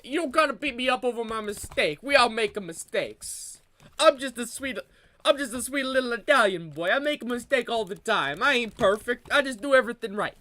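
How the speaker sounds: put-on hammy Italian-American pleading accent; mock Italian accent.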